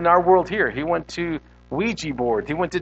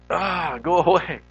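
slow mock kitten-like protest; feeble plea to a problem. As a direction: imitate a weak little dismissal. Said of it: "when we tell our problem".

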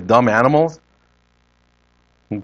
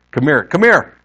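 "Come here, come here", the second one pitched up and punched; emphatic repeat; 2 semitones higher, 3 dB louder than usual. Shown as a demonstration. Preacher demonstrates doubled words for congregation, emphatic second "come here". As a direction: say "come here" twice, hitting the repeat harder. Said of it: "That second emphasis".

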